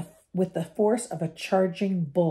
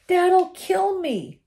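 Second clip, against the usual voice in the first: high, slow, loud wail; desperate yell; 12 semitones higher, 5 dB louder, much slower than usual. A narrator's reading. Ron yells after the runaway car, desperate. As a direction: cry out in dismay.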